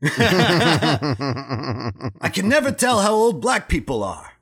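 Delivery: laughing through a put-on Russian accent. The Russian prisoner muses about Sam's age, lightly Russian accent. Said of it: "my Russian accent".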